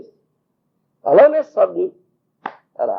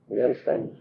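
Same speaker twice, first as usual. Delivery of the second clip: ordinary talk, nothing marked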